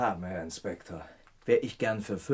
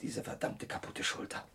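whispered through the pain, much softer; 10 dB quieter than usual. Acted. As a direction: hiss it out, the shoulder hurts. Verletzter klagt über Schulter. (schmerzerfülltes Flüstern)

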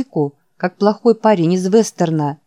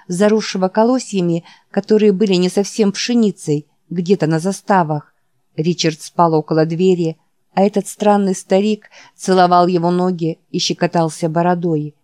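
ordinary delivery

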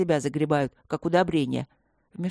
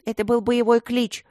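higher, louder, mock-fierce; menacing declaration; 5 semitones higher, 3 dB louder than usual. A narrator's reading. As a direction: declare it proudly, threatening. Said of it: "грозно заявила гномка".

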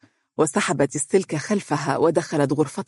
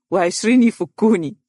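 mock whimpering strongman; 5 semitones higher, 4 dB louder than usual. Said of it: "وبعدين يعيط ويقول".

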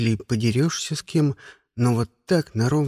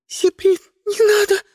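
very high, frightened squeal; piercing girlish shriek; 4 dB louder than usual. A narrator's reading. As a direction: squeal the plea in terror. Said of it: "заверещала девчонка".

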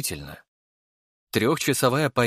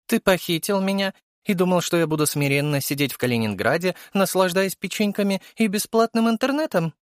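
high female character voice, lightly ironic; a touch of irony; 9 semitones higher than usual. A narrator's reading. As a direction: tease with mock incredulity. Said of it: "спросила она с долей иронии в голосе".

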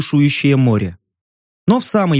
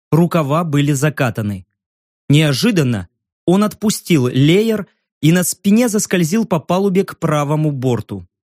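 usual speaking voice

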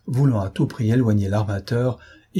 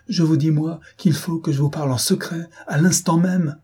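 urgent, high-pitched insistence; alarmed; 7 semitones higher than usual. A narrator's reading. Gunner demanding a private word at once, alarmed. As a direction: press each phrase with rising urgency. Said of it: "s'écria le maître canonnier véritablement alarmé".

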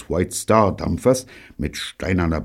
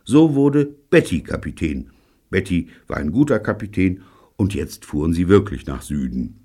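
ordinary delivery

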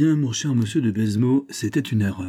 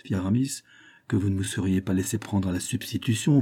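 ordinary delivery

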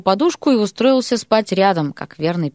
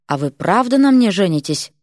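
ordinary delivery, nothing marked

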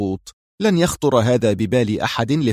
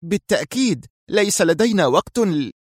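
high-pitched, careful female reply; cautious; 8 semitones higher than usual. A narrator's reading. Mira answers her stern boss, cautious. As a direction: answer warily in a raised voice. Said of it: "أجابته في حذر".

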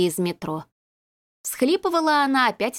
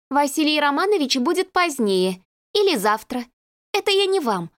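flustered secretary; 6 semitones higher than usual. A narrator's reading. Lyudochka to visitor and phone.

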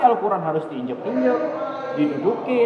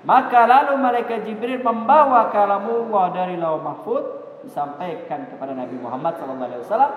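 ordinary talk, nothing marked

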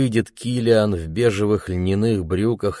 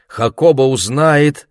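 plain reading voice

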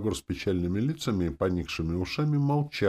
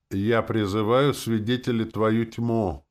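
slow, raised, loud proclamation; solemn declaration; 6 semitones higher, 4 dB louder, slower than usual. A narrator's reading. Hero invoking darkness as witness, solemn declaration. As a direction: proclaim it ceremoniously, word by word. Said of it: "начал я торжественно".

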